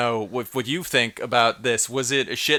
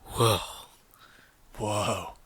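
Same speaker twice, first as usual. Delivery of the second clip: Keanu Reeves whoa; 4 semitones lower, 5 dB quieter than usual. Shown as a demonstration. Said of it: "Keanu Woe".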